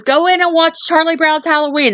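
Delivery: high-pitched retold parental order; lightly acted dad. Dad to his yelling son at the window, lightly acted order. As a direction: lightly play a dad sending a kid in.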